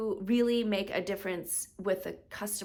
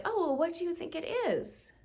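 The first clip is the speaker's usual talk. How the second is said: high-pitched mock doctor's question; imitated doctor; 8 semitones higher than usual. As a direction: put on a high doctor voice, asking the patient. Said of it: "And they're like".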